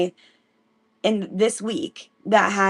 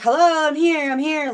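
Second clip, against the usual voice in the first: eager reply; 7 semitones higher than usual. Retold or imitated. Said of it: "He's like".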